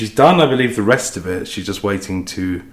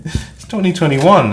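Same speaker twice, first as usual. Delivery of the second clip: imagined viewer's disbelief; 7 semitones higher, 4 dB louder than usual. Retold or imitated. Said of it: "I know you're saying".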